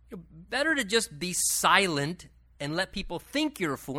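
ordinary delivery

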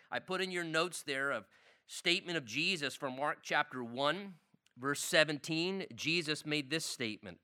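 ordinary talk

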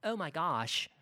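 put-on airy, gossipy Valley Girl; lightly voiced Valley Girl. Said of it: "Valley Girl voice".

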